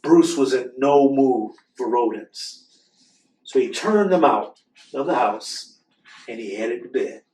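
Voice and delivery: lively storybook read-aloud; reading to children. Acted. Host reading a book to children, animated storytelling. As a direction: read the picture book to the kids with expression.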